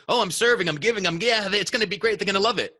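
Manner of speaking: high, chirpy imitation of self-assurance; mock eager. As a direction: play your naive upbeat self. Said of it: "you could think".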